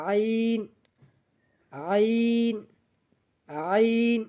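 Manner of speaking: "আইন" drawn out from a pressed mid-throat; squeezed throat sound. Shown as a demonstration. Teacher showing learners the letter, "আইন" long with tightened throat. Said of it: "গলা চেপে ধরে".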